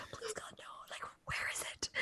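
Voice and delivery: pleading whisper, barely audible; terrified whisper. Retold or imitated. Liam hiding from the ghost, whispering his plea. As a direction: beg in a whisper while hiding. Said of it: "he's just, like, really quiet".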